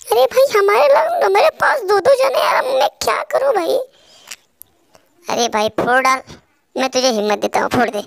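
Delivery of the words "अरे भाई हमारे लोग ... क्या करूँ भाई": put-on high, loud, pleading girlish voice; much higher pleading voice calling a friend for backup; panicky teammate. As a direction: squeal for help like a scared teammate in a firefight. Teammate outnumbered by enemies.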